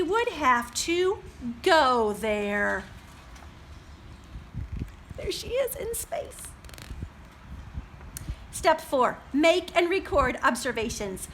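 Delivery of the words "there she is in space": high, hushed wonder. A narrator's reading. Librarian showing the page to children, high and wondering. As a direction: marvel at the picture.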